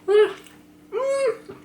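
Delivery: talking through a hot bite; gasping round scalding food; mouth full of hot food. Real, not acted. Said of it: "ağzım yandı".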